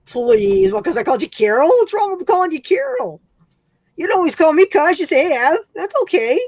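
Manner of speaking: high, sing-song coaxing; playful pleading. Real, not acted. Begging her cousin to come hang out, high and whiny.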